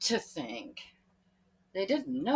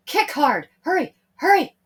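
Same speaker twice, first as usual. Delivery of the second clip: urgent; 8 semitones higher, 10 dB louder than usual. Acted. Peter urging himself swimming.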